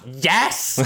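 imitated triumphant scream of YES; gloating mock jubilation; inward cheer. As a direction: let out a drawn-out, gleeful mock yes. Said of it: "Hör man ju att han skriker inombords".

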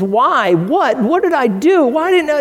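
rising from a question to a high, desperate cry; mock anguished plea to heaven, climbing in pitch; pleading to heaven. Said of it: "looks to heaven and says".

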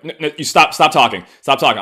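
hammed-up friends begging her to stop; friends pleading. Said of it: "her friends are like".

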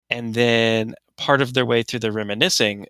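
ordinary talk

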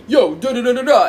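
loud mock bro babble; generic guy impression. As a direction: blurt it out like some guy. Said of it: "when I imitate guys".

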